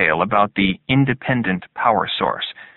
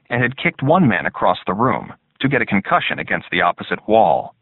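plain reading voice

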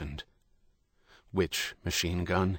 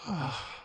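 long breathy sigh fading out; the priest sighing after his tears; heavy sigh; 8 semitones higher, 10 dB quieter than usual. A narrator's reading. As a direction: let out a great sigh. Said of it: "Another great sigh shook him".

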